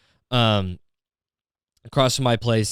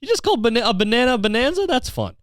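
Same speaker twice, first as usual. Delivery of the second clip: much higher, mock-amazed voice; mocking other people; 12 semitones higher than usual. Mimicking people charmed by his bonanzas joke in a high mock voice. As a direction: ham up the impressed onlookers. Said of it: "They're like".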